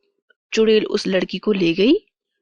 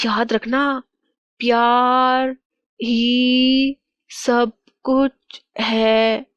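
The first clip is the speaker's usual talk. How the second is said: higher voice, words drawn out very slowly; echoed spirit message; 4 semitones higher, much slower than usual. Retold or imitated. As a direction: intone it like a remembered message from a spirit. King recalls the spirit's words. (गूँजती याद, खिंचे हुए शब्द)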